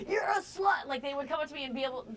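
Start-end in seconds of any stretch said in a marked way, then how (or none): 0.1-0.8 heckler shout. Retold or imitated. Imitating a guy yelling an insult at the comic. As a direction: bark it like a rude guy after the show. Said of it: "be like".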